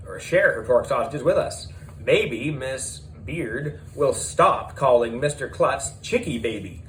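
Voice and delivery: ordinary delivery, nothing marked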